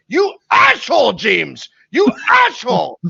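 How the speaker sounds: furious at himself, high-pitched; hammed-up self-directed shouting; imitated screaming dad. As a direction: scream at yourself in bursts, punching along. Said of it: "And as he's screaming".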